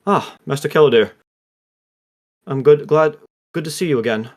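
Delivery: lightly voiced Welsh lilt, dazed and glad; Welsh accent. Revived Lou greeting his master Keladir, light Welsh accent. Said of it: "he now has a Welsh accent".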